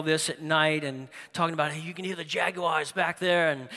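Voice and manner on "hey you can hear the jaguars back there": badly faked British accent; terrible British accent. Survivalist talking to his camera at night, bad British accent. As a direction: attempt a British survivalist, and miss. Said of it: "that was a terrible accent".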